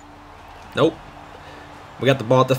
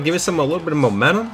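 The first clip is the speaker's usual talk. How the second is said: ordinary talk, nothing marked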